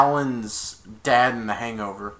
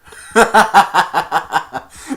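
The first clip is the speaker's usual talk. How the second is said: high, loud real laugh; real burst of laughter; 6 semitones higher, 12 dB louder than usual. Real, not acted. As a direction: crack up at the joke. Laughs at the Grinch line.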